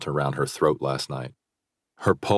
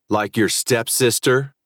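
sly taunt; 6 semitones higher than usual. A narrator's reading. Rival taunting.

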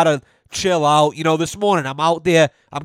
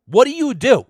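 incredulous demand; 7 semitones higher than usual. Retold or imitated. Pressing the kid at dinner.

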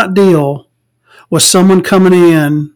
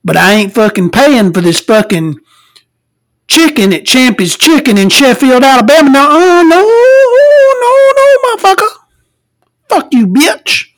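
rude restaurant customer; 8 semitones higher than usual. Retold or imitated. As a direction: ham up the foul-mouthed woman at the next table, pitch way up. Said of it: "give you a little sample".

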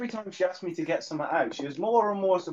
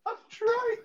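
protesting; slower than usual. Retold or imitated. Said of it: "I'm like".

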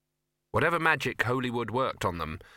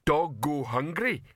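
slow, high-pitched lumbering troll; slow dim-witted troll voice; 4 semitones higher, slower than usual. Acted. Detritus the troll speaking.

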